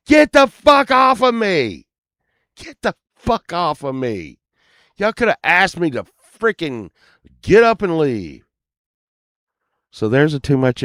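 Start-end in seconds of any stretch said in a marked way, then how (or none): 0.1-8.4 high, loud angry shout; shouting at the couple on his face; retold yell. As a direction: yell at them to get off. Retold yelling at drummer.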